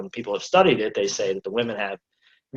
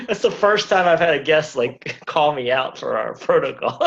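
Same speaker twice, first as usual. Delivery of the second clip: laughing; 6 semitones higher, 4 dB louder than usual. Real, not acted. Host laughing while talking.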